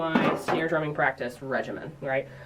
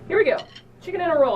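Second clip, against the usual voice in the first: much higher and louder, a hyped announcement; excited kickoff; 10 semitones higher, 4 dB louder, much faster than usual. Real, not acted. Drum teacher launching the final full play-through, excited. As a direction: pump it up like the big finale is starting.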